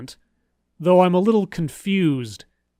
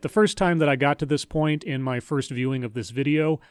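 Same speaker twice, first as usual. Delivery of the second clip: ordinary delivery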